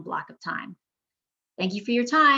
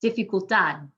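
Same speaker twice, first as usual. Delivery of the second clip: "dificultad" stressed di-fi-cul-TAD; last-syllable stress. Shown as a demonstration. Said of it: "emphasize the last syllable".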